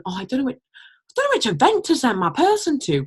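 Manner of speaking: whiny, rising to high complaint; a hint of a whiny rep; mock moaning. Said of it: "sit here and moan".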